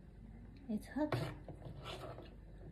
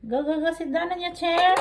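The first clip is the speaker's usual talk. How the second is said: loud urging; 7 semitones higher, 10 dB louder, faster than usual. Real, not acted. Urging child to sit.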